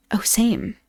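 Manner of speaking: ordinary delivery, nothing marked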